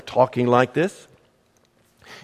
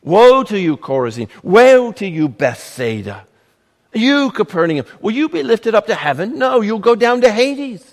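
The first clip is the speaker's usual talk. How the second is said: higher, louder denunciation; Jesus denouncing the towns in a raised, stern voice; 6 semitones higher, 4 dB louder than usual. Acted. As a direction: thunder the woes at the towns. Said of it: "Jesus began to denounce the towns".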